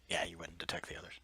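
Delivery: quick hushed whisper. Acted. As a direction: whisper it fast to the table. GM whispering a secret.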